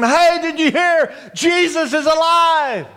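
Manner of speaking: high, excited crowd cry; mock screaming. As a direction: spread astounding news with a high call. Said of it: "the whole city probably began to scream".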